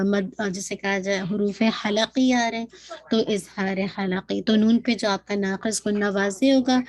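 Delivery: ordinary talk, nothing marked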